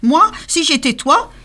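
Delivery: renne aigu qui donne un conseil; voix du renne. A narrator's reading. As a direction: conseiller d'un ton malin. (le renne conseille le Père Noël)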